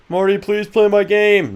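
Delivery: high, slow pleading mock voice; begging chatter. Streamer imitating a begging viewer, mock whine. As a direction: whine it like a needy fan.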